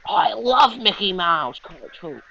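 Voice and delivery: high, slow gushing impression; mocking, high-pitched fan voice; put-on character voice. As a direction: imitate him gushing, drawing out the words. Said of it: "When I asked him about it, he's like".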